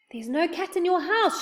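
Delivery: high, insistent call; shouted. A narrator's reading. Moonface correcting the deaf saucepan man, high-pitched shout. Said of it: "shouted Moonface".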